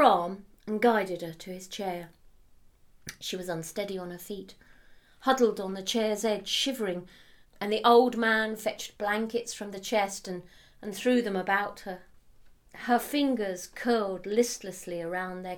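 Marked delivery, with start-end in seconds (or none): none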